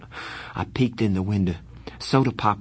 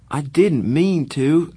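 higher, slow pleading; 7 semitones higher, 5 dB louder, slower than usual. Acted. Pony pleading with his angry brother. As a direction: beg him to understand. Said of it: "I pleaded with Darry".

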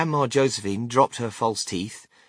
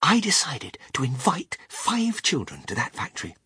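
breathy whispered voice; whispering; 4 semitones higher than usual. Acted. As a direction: let it out as a secret. Wonka explains, whispered.

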